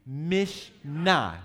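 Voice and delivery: "Mishnah" in two deliberate beats, loud, accent on the final "nah"; second-syllable stress. Shown as a demonstration. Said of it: "Might as well accent the second syllable".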